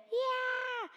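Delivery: long high falling scream. A narrator's reading. Grinch dragged over the cliff, long scream. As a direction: scream as you go over the edge. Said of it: "the Grinch screamed".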